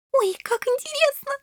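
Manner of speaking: put-on very high delighted girlish squeal; happy squeal. A narrator's reading. Shelli thrilled at coming fight, happy squeal. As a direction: squeal with glee like a little princess. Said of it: "счастливо взвизгнула Шелли".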